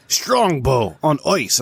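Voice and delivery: slow, drawn-out imitation Irish accent; amateur Irish accent, vowels stretched; attempted Irish accent. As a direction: spell it out slowly in a rough Irish accent. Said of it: "I wish I could do an Irish accent".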